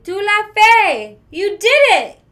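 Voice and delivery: loud, high joyful shout; overjoyed cry of success. Acted. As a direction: shout it out in triumph. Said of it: "he shouted".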